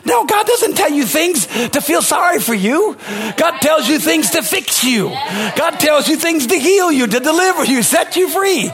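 high, slow, emphatic preacher's declarations; impassioned preaching. Real, not acted. Preacher tells congregation why God speaks to them, preaching fervently.

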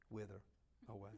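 "Wither away" dropped to a soft, sinking fade; fading wither. Shown as a demonstration. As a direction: let "Wither away" shrivel into near silence.

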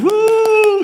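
high, loud, cheering whoop; crowd cheer. Retold or imitated. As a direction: whoop like an excited audience. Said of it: "other people are standing up going".

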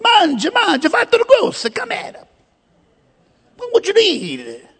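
high-pitched, hammed-up Italian waiter; playful mock Italian accent. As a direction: greet old pals as an effusive Italian headwaiter. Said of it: "in his Italian accent".

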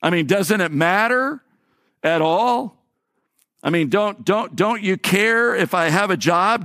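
a worn-down believer begging the Lord, pleading; pleading prayer. Acted. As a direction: plead with God as if he isn't listening. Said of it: "have a tendency to say".

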